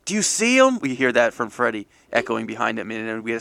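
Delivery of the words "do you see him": much higher and louder, calling down a hole. Acted. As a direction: shout the question down into the dark.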